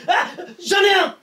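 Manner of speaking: high shouted alarm call; panicked shout. Acted. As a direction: yell for the guard in panic. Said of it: "un peu plus rapide".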